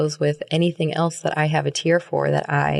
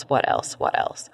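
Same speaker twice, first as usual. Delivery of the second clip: fading to a whisper; 5 semitones lower, 3 dB quieter than usual. Acted. Repeating what else to herself in a fading whisper. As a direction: let the question fade to a breathy whisper.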